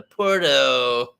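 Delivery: drawn-out mock American Valley Girl; Valley Girl accent. Shown as a demonstration. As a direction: stretch it out in a put-on American accent. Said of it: "Valley Girl".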